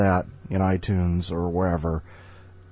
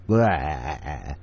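mock vampire blah; 5 semitones lower than usual. Shown as a demonstration. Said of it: "I like doing that".

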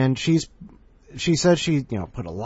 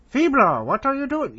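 much higher, louder alien character voice; character line; 6 dB louder than usual. Acted. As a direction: pitch it way up for the alien. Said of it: "lines that I need to record for Christiana Ellis".

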